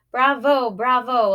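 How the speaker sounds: high, loud cheering; hearty bravo. A narrator's reading. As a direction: applaud the actors with gusto. Teacher applauding performers.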